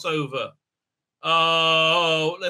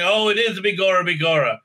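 put-on generic Irish accent; 3 semitones higher, faster than usual. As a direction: ham up a cheesy stage-Irish harfoot. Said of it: "very generic insulting Irish accent".

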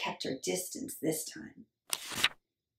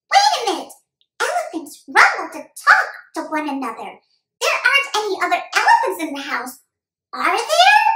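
put-on high, loud kid voice; boy working out a mystery; puzzled young boy; 13 semitones higher, 12 dB louder, faster than usual.

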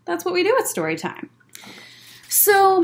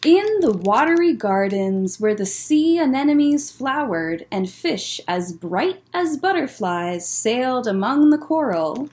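story narration; 4 semitones lower, slower than usual. Acted. Reading the picture book aloud.

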